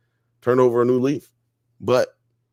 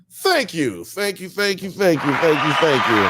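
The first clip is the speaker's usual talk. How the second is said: hammed-up comedian taking a bow, higher; mock stage thanks; 6 semitones higher than usual. To chat after a compliment, hammed-up stage thanks. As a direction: thank the crowd like a star.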